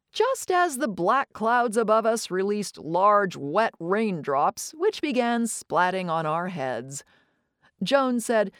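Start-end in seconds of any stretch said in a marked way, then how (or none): none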